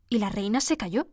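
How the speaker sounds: usual speaking voice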